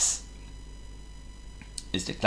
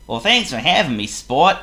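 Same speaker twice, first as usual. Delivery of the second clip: loud, high, bright Clumsy hello; cheerful greeting; 6 semitones higher, 4 dB louder than usual. Acted. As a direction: sing out a happy good morning. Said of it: "he said to Mister Fuzzy".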